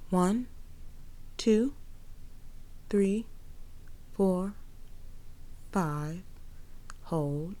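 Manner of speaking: slow, soft breath counting. Acted. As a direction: count the breaths slowly and softly.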